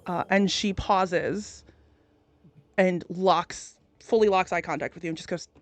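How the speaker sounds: usual speaking voice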